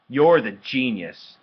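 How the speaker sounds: loud, slow, pointed sarcasm; sarcastic jab. A narrator's reading. Brother taunting his know-it-all sister, sarcastic.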